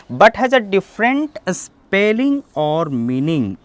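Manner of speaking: very slow, word by word definition; slow careful classroom dictation; slow dictionary reading. Shown as a demonstration. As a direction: spell out the meaning slowly for students. Reads English definition aloud.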